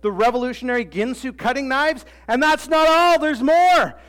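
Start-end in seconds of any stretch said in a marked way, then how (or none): 2.3-4.0 loud, high TV salesman hype; infomercial pitchman. Retold or imitated. Preacher hamming up an infomercial pitch, loud and high. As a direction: sell it like a late-night ad.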